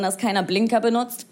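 usual speaking voice

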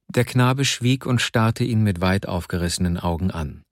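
usual speaking voice

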